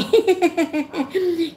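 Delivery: bright burst of real laughter; giggle. Real, not acted. Laughs at scorpion joke.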